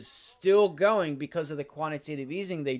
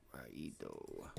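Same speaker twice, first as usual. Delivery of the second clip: deep drawn-out murmur; 19 dB quieter than usual. Real, not acted. Repeating the word at the chart.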